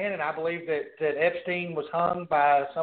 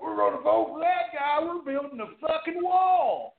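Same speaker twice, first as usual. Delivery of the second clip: mock voter rallying; 8 semitones higher than usual. Host mimicking voters excited about the wall, high mock rallying cry. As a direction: imitate a worked-up crowd, pitched way up.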